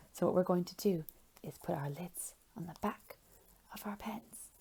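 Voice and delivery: hushed instructions; whisper. Shown as a demonstration. Teacher whispers instructions to children, quiet. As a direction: whisper it to the class. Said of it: "teacher whispering instructions".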